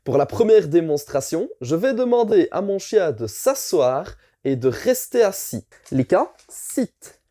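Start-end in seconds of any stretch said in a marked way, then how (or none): 5.9-7.2 slow firm command to the dog; talking to a dog. Shown as a demonstration. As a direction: give the dog a calm command.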